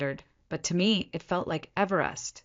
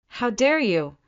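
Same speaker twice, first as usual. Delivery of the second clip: higher, narrated maternal outrage; enraged hiss; 6 semitones higher, 4 dB louder than usual. A narrator's reading. Mother storming into the hospital room, indignant and angry. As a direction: voice a mother's rage, pitched up. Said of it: "Mom hissed, her voice shaking".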